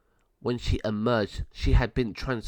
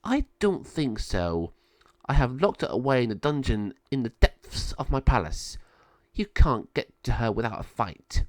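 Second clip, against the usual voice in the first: gloating villain; 2 semitones higher than usual. Acted. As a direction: savour the refusal like a cat with a mouse. Malvador refuses, gloating.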